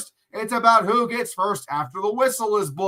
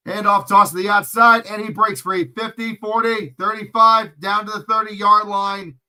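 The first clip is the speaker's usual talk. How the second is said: lively sportscaster call, louder on the break; play-by-play call; 5 dB louder than usual. Acted. Narrating the run for viewers, a lively play-by-play call.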